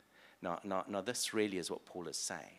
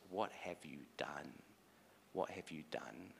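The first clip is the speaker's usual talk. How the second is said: low, hushed question; quiet, pained reproach; hushed dismay; 4 semitones lower, 7 dB quieter than usual. Acted. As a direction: ask it softly and low, nearly under the breath. God grieves over wrecked world.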